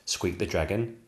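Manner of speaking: plain reading voice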